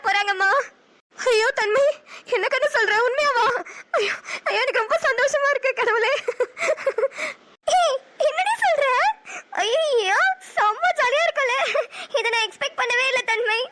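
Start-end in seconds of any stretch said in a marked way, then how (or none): 4.6-11.6 high, teary cries of joy; overjoyed. Acted. Sister thrilled to hear of twins, high teary joy. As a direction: squeal it out through happy tears.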